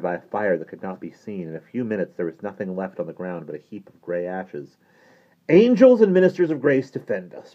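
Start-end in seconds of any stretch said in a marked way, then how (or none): 5.5-7.5 high, loud plea for protection; loud, high-pitched awed appeal; alarmed priest's invocation. A narrator's reading. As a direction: cry out a prayer in alarm. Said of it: "breathed Father Higgins as he crossed himself".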